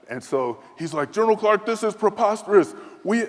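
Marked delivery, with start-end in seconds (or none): 1.1-3.3 higher, louder mock outrage; outraged general. General scolding the visiting officer, high and loud. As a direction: play the general scandalized. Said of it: "when he used the word preposterous".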